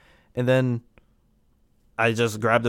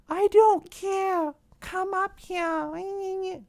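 much higher and slow, imitating his girlfriend; high mock girlfriend; much slower than usual. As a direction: mimic her in a squeaky high voice. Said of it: "she's like".

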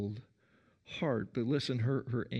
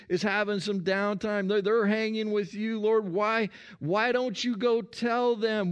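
higher and louder, an exasperated, put-upon protest; Martha's indignant complaint; 8 semitones higher, 4 dB louder than usual. Acted. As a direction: play Martha fed up that nobody else helps. Preacher acting out Martha's complaint.

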